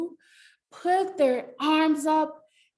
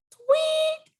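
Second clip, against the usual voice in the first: very high chirped tweet; squeaky bird call. Pretend. Bird sound imitation.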